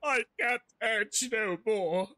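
high, slow bro drawl; hammed-up slow bro voice; voicing Helios. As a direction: play Helios as a dopey bro. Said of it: "He's like".

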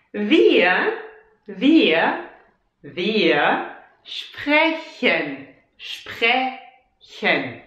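wir sprechen drawn out very slowly. Shown as a demonstration. Teacher drilling wir sprechen slowly. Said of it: "So let's say it slowly".

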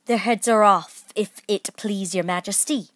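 dutiful shout to a superior; shouted reply. A narrator's reading. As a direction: call it back loudly. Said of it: "the soldiers shouted in reply".

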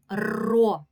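ро with a long rolled р; lengthened trill starting ро; drawn-out sound. Shown as a demonstration. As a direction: trill the р before the о in ро. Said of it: "Починається звука р".

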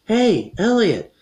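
loud, high, drawn-out calls; stretched-out hollering of names; calling for attention. Acted. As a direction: hail friends from across the way. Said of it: "trying to get their attention".

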